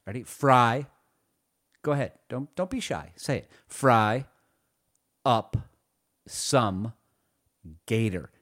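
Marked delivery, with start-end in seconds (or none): 0.2-0.9 "Fry" said loud, on its own; word drilled alone. Shown as a demonstration. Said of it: "Say, say what I say". 3.7-8.3 "Fry up some gator" with a pause after each word; loud, separate words for repeating; word-by-word teaching. Shown as a demonstration. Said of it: "Just repeat after me".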